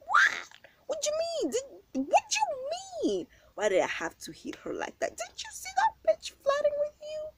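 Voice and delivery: very high incredulous questions; retold outrage. To her man asking why she hit her, very high incredulous shrieks. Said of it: "I was like".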